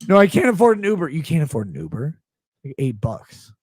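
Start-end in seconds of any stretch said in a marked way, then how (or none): 0.1-1.1 fast, louder whiny mock reply; mocking John's excuse, high and fast; mock whine. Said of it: "Texting me this". 1.1-2.2 answering the fan with mock disbelief; incredulous. Incredulous reply in mocked dialogue.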